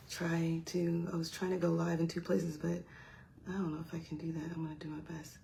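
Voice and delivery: very soft, half to yourself; murmured aside, fading almost to a whisper; quiet self-talk. Real, not acted. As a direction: talk under your breath while busy. Mumbles to self while fiddling live.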